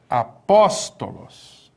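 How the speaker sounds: Apóstolos said very slowly, syllable by syllable, stress on the second syllable pó; slow teacher demo of Apóstolos. Shown as a demonstration. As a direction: spell out Apóstolos slowly with a clear accent on pó. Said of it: "Onde que está o acento?".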